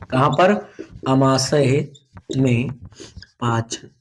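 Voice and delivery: word by word, long gaps between words; slow dictation. Shown as a demonstration. Teacher dictating a heading to pupils, slow dictation. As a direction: dictate slowly with a pause after each word.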